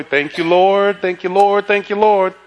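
repeated, chant-like thanks; mock relief. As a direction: play up a grateful little prayer of relief. Acted relief at avoiding someone.

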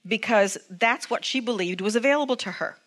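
normal talking voice

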